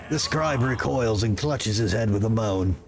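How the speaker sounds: low, even narrator voice; story narration. A narrator's reading. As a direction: set the scene calmly.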